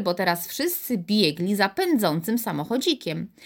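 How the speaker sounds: plain reading voice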